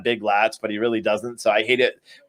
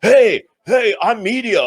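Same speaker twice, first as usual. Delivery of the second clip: higher, louder mock plea; mocking cameraman; 7 semitones higher, 4 dB louder than usual. Streamer mocking the cameraman pleading with protesters, higher and louder. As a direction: ham up a cameraman pleading he's press. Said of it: "and he's like".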